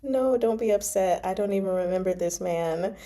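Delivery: normal talking voice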